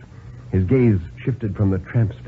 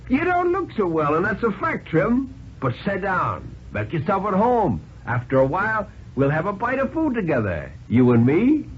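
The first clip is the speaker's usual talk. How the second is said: much higher, sly hobo playing host; mock-friendly tramp; 9 semitones higher than usual. A narrator's reading. Tramp mockingly welcoming the weak banker, high and sly. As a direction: fake hospitality with a grin in it.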